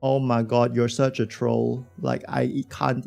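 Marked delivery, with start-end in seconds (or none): none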